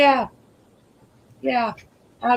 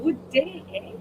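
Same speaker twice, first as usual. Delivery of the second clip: ordinary talk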